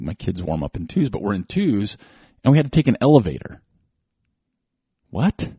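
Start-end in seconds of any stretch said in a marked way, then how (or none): 5.1-5.5 incredulous. Real, not acted. Telling listeners about the absurd dream elevator, high incredulous surprise. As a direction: sound amazed that a race needs an elevator.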